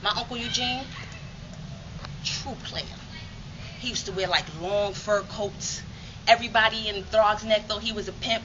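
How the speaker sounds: ordinary talk, nothing marked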